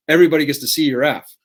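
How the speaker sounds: mock-loud jab; loud mock announcement. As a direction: make it sting like a public notice. Mocking emphasis on public shame.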